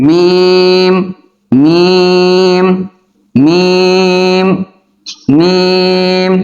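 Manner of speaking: মিম with lips closed, the m held four beats long; long drawn-out meem. Shown as a demonstration. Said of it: "খাতার মধ্যে এভাবে লিখবেন".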